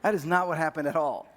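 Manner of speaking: normal talking voice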